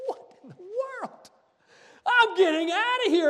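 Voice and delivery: high, frantic cry of alarm; startled herder crying out then fleeing; panicked swineherds. Retold or imitated. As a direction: yelp it like a man bolting in fright.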